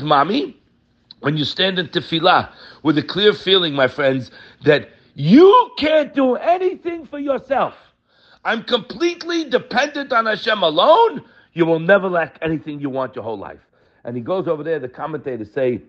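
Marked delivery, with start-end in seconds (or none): 5.1-11.2 fervent preaching. Real, not acted. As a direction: stretch every word with rising fervor. Preacher building fervent climax.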